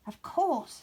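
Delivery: mild eureka; sudden idea. Acted. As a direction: realise it in a flash. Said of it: "said Winnie".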